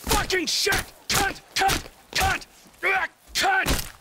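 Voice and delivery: enraged shouting. Acted. Trevor raging while beating Johnny, furious shouting. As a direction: spit each word out in blind rage.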